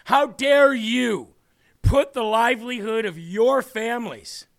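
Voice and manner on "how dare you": normal talking voice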